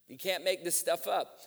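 ordinary talk

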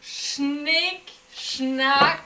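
each word stretched out, rising high; slow motion. Real, not acted. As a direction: drag out the countdown in slow motion. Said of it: "Warum machen wir das so in Zeitlupe?".

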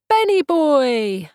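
loud, high, drawn-out hail across a crowd; calling out. Acted. Voice in crowded bar hails Ben, loud stretched call. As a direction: shout his name over the bar noise. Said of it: "a voice calls from the throng".

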